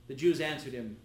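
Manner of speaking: ordinary delivery, nothing marked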